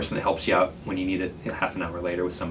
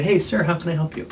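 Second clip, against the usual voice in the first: friendly; 9 semitones higher than usual. Shown as a demonstration. Librarian greeting a homeless patron with respect, friendly and bright. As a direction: greet him like a valued guest. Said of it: "But if I can just say".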